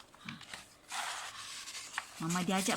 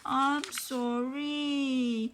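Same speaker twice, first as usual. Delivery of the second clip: elephant apology; 6 semitones higher, much slower than usual. Acted. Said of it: "gajah cakap".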